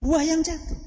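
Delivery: put-on high child's answer; small boy's voice. Rafi' explains what he eats, high child voice. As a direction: answer simply, like a little boy. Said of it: "Apanya yang dimakan?".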